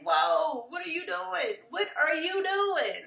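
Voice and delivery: high, incredulous questioning, slowing on the repeat; mock shock. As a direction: call someone out in disbelief. Acted shock at Cynthia's behaviour.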